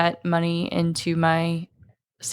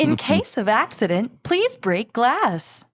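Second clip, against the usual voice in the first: slow, deliberate safety announcement, slightly higher; flight attendant; 4 semitones higher, slower than usual. Acted. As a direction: read it like cabin crew safety instructions. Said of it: "That's my serious voice".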